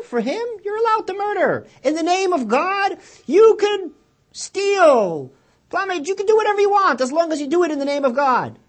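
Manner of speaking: high, loud scornful outcry; mock fanatic reading. Preacher mocking the zealots' reading, loud and high. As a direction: sneer it out like a zealot.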